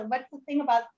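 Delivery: usual speaking voice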